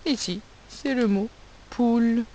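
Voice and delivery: ordinary talk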